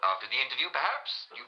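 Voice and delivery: normal talking voice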